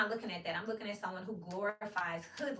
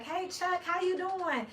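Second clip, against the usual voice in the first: cheerful greeting; 9 semitones higher, 4 dB louder, slower than usual. Retold or imitated. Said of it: "I'll be like".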